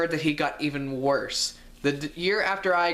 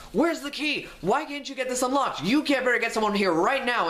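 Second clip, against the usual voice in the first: imitating angry teacher; 8 semitones higher than usual. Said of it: "He was constantly just like".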